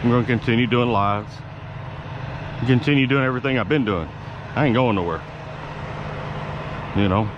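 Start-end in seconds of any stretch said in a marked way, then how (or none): none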